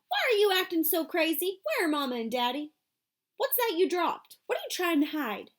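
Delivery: put-on a young girl pressing her brother; little sister Joey, alarmed. A narrator's reading. Narrator voices little sister Joey.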